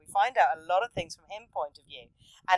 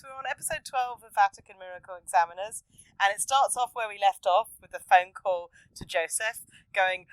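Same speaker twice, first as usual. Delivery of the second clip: ordinary talk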